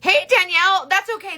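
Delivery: high, loud opening hail; high-pitched, delighted hello; excited greeting. Real, not acted. Greeting a viewer who appeared.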